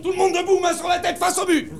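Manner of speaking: high, commanding drill call; barked orders. Acted. Exasperated guide ordering tourists against the wall, commanding call. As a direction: order them about in a high, projected voice.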